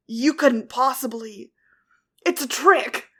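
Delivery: angry denial. A narrator's reading. Cat Blanc denying.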